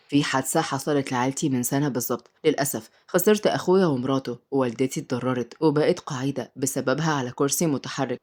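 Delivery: low male character voice, subdued; restrained, deep-voiced man; sorrowful uncle. A narrator's reading. Uncle tells of the accident.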